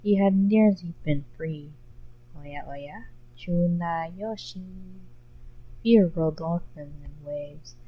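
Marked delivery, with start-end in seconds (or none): 2.4-5.0 soft, slow, menacing drawl; menacing purr. Acted. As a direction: draw the name out slowly like a threat. Menacing greeting from the shadow.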